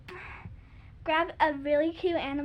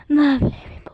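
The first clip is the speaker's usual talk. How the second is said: whisper; 10 dB louder than usual. Shown as a demonstration. Whispered song start.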